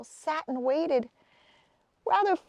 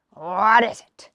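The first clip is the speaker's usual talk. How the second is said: slow, louder troll grunt; 3 semitones lower, 6 dB louder than usual. A narrator's reading. Troll grunts at Hazel, slow and louder. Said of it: "the troll grunted".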